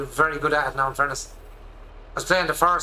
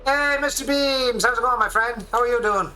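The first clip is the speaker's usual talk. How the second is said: cheery greeting; 8 semitones higher than usual. Real, not acted. Greeting friend over voice chat.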